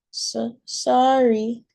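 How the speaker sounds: put-on high, slow, halting little girl's sorry; tearful child apology. A narrator's reading. Maylee apologizing to mom after crying, high slow child voice. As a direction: stammer it out like a crying toddler.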